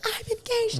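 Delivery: high, thrilled squeal; excited scream. Retold or imitated. Showing her ring to friends, high excited squeal. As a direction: shriek the news to a friend. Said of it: "and I'm screaming".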